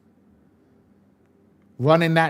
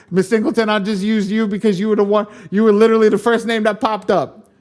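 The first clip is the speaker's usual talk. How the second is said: loud, raised-pitch comic reply; high, loud mock-conversation voice; acted reply; 8 semitones higher, 8 dB louder than usual. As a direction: answer the falsetto caller, pitched up and loud. Acted reply to the fake caller.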